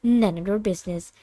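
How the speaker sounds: usual speaking voice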